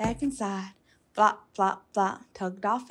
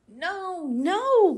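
higher protesting no, no; high, louder childish protest; crying out; 8 semitones higher, 5 dB louder than usual. A narrator's reading. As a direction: push back like a kid refusing. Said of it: "cried Froggy".

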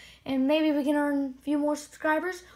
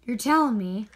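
normal talking voice

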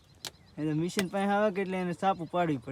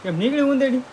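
loud, raised shout of a question; calling out; 9 semitones higher, 10 dB louder than usual. Real, not acted. Asking the worker loudly whether the rat came out. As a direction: call the question out across the field.

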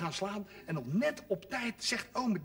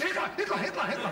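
mock yell; 6 semitones higher than usual. [name] yelling at the dog.

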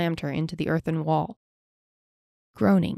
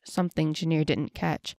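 usual speaking voice

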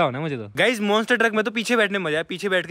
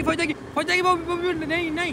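alarmed; 10 semitones higher than usual. Real, not acted. Truck entering swamp.

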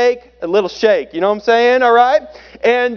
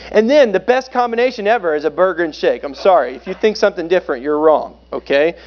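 normal talking voice

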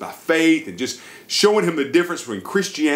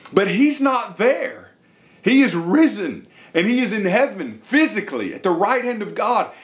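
fervent proclamation; 6 semitones higher than usual. Real, not acted. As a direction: proclaim it like the climax of a sermon, a laugh breaking in. Preacher proclaims the risen Jesus.

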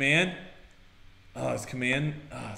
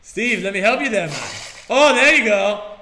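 mock alarm; 4 semitones higher, 7 dB louder, slower than usual. Helping Steve.

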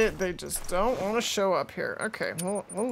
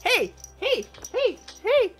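much higher, playful voice for a pet; cat-talk voice. Retold or imitated. Talking to her fussing cat, high playful pet voice. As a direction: coo at the cat like it's a baby. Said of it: "And I'm like".

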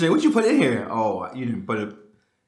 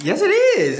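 insistent; 4 dB louder, slower than usual. Real, not acted. Arguing over accident.

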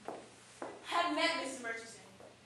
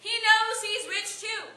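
loud, high-pitched exasperated comeback; exasperated retort; 7 semitones higher, 5 dB louder than usual. Acted. Young woman answering sister-in-law about suitor, exasperated. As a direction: fire back, raised in pitch and volume.

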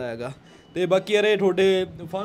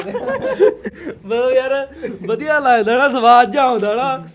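laughing; 7 semitones higher, 8 dB louder, much slower than usual. Real, not acted. Laughing after the prank.

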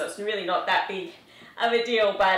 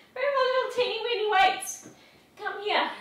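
put-on squeaky baby talk; 12 semitones higher than usual. Playful baby-talk call to weights.

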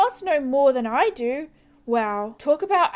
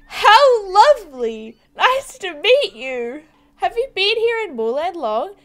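fake sweetness; 5 semitones higher, 7 dB louder, slower than usual. Fake politeness after insult.

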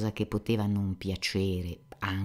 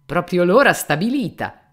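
bursts out loud at the stroke of noon; loud exclamation; 7 semitones higher, 12 dB louder than usual. A narrator's reading. Fyodor Pavlovich exclaims loudly to the elder. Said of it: "esclamò Fyodor Pavlovich".

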